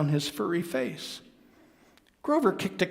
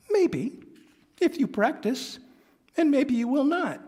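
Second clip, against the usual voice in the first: high, gentle honest mom; lightly voiced Grover's mother; 12 semitones higher than usual. Mother answering Grover, high gentle voice. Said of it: "letting me do the voices".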